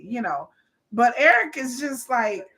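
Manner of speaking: normal talking voice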